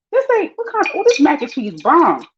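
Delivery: squeaky voice of fooled people; high mock voice of eaters. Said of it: "They be like".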